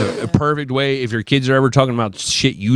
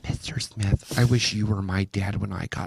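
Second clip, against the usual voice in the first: hushed confession; whispering; 8 semitones lower, 11 dB quieter than usual. Retold or imitated. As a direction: breathe it softly. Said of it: "the principal goes".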